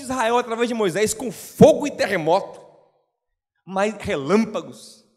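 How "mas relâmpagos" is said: ordinary talk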